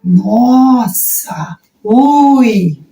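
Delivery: long drawn-out "Nossa" and "ui"; demonstrative wow, stretched out; acted reaction. Shown as a demonstration. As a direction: draw out each exclamation in amazement. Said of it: "Isso é marcação não manual".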